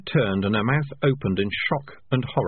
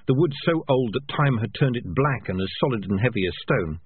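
plain reading voice

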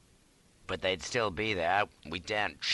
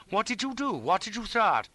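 high, urgent questioning; alarmed cry; 12 semitones higher, 3 dB louder than usual. A narrator's reading. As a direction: demand it in rising alarm. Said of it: "he cried".

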